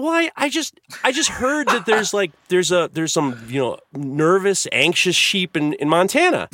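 high, halting explanation; flustered young boy. Retold or imitated. Boy explains himself to dad's associate, high and halting. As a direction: stumble through it like a nervous kid. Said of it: "And I said".

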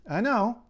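"I know" pitched high and quick; varied intonation. Shown as a demonstration. Linguist showing how intonation changes meaning, high-pitched "I know". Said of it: "your intonation can make a huge difference".

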